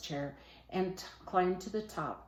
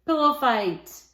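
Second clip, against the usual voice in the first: loud, high shout of glee; 7 semitones higher, 12 dB louder, slower than usual. A narrator's reading. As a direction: yell it out to start the fun. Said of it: "and shouted".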